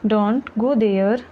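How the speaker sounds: slow, careful model reading of Don't go there; slow repeat. Shown as a demonstration. Teacher repeating Don't go there for learners, slowly. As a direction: say it again slowly so learners follow. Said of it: "teacher repeats sentence slower".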